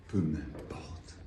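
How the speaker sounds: slow, low, near-whispered dismissal; breath-soft poetic aside; hushed aside. Acted. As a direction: let "Peu m'importe" fall away very softly. Poem line murmured, then repeated fully.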